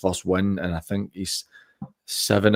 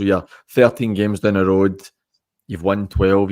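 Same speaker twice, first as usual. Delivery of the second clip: ordinary talk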